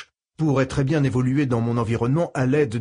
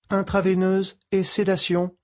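computer voice; 8 semitones higher than usual. Acted. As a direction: read it like a calm medical computer. Ship computer speaks.

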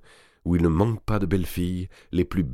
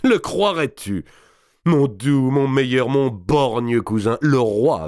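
loud, mocking noble, higher; boisterous lord; 6 semitones higher, 5 dB louder, slower than usual. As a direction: sneer at the one-eyed cousin. Robert d'Artois voiced in story. (un seigneur tonitruant et moqueur)

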